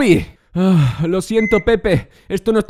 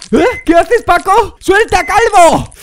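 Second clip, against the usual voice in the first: loud, high panicked yelling; alarmed shouting; 8 semitones higher, 6 dB louder, slower than usual. Acted. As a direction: shout in alarm at someone grabbing you. Patient grabs him during operation.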